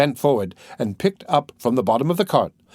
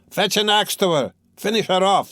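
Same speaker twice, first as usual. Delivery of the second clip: brutal shout; 7 semitones higher than usual. A narrator's reading. Spectator urging Mikolka to finish her, brutal shout. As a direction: call for the kill. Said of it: "shouted a third".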